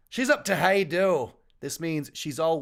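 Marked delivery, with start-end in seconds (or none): 0.1-1.3 hesitant Scottish attempt; attempted Scottish accent. Shown as a demonstration. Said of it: "I don't know if I'm doing that right".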